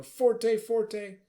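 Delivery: high, loud, emphatic "forte". Shown as a demonstration. Conductor demonstrating dynamics for drama, loud high forte. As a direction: punch it out strongly. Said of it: "So bring the drama here".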